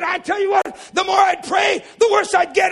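high, loud, breathless-with-news testimony; excited shouting. Acted. Brother recounting his prayer to preacher, excited shout. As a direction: pour it out at top volume.

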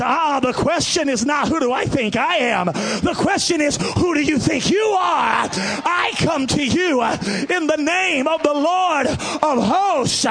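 loud high defiant proclamation, slowing down; defiant shout. Acted. Child of God shouting back at Goliath, defiant. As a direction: hurl it back at the giant with full force. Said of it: "the child of God looks back at Goliath and says".

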